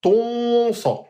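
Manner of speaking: Ton sa with a full dark o and nasal n; dark emphatic ton. Shown as a demonstration. Teacher contrasts right and wrong nasal, correct take. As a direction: say Ton sa with a rounded, heavy o.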